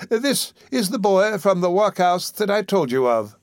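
high, slow, meek husband; hesitant, placating undertaker voice; deferential. A narrator's reading. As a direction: explain carefully to a formidable wife.

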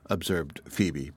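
ordinary delivery, nothing marked

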